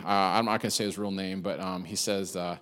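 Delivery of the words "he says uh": normal talking voice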